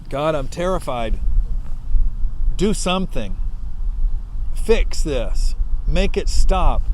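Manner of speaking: loud, pleading cry to God; desperate prayer. Acted. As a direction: demand God fix it, calling out. Preacher voices frightened prayer.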